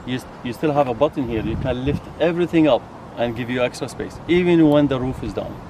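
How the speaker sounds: ordinary talk, nothing marked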